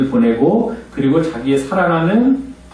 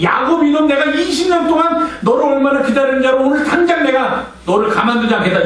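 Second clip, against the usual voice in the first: much higher, mock threatening shout; higher mock threat to Jacob; imagined angry Esau; 10 semitones higher than usual. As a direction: play the furious brother, hammed up.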